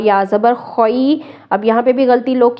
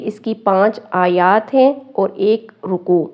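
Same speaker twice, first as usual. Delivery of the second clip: ordinary talk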